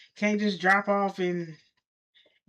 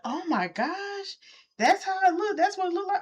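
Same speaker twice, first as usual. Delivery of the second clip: surprised; 12 semitones higher than usual. Retold or imitated. Stunned reaction to the photos.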